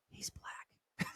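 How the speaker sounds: hushed, shocked whisper; stunned whisper under your breath. Retold or imitated. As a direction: whisper it so nobody hears. Shocked aside in silent crowd.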